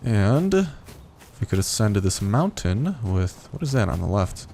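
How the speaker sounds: normal talking voice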